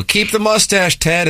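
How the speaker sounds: high yelled fan call. Retold or imitated. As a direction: call it out from the gallery. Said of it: "people yell".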